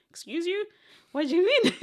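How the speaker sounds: high-pitched offended disbelief, acted out; scandalised high retort, played for laughs; mock incredulity. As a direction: squeal it in mock outrage, ending loud. Retold offended reaction to sadza deniers.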